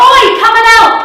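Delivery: high, loud call across the road; urgent shout across the street; shout for help. Retold or imitated. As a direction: yell for the bouncer to come over. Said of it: "I shout at him".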